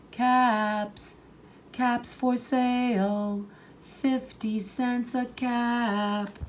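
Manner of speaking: high, slow sing-song street call; peddler calling out. A narrator's reading. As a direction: call out your wares to the whole street. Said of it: "he called".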